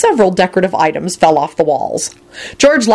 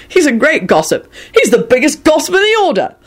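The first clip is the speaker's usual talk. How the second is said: George voice; 10 semitones higher than usual. Acted. George continues teasing.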